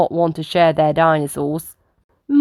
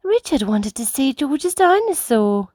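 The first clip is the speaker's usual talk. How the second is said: Mummy Rabbit; 7 semitones higher than usual. Acted. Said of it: "says Mummy Rabbit".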